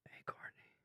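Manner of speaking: soft breathy whisper. Real, not acted. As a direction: whisper her name. Whispering to guest.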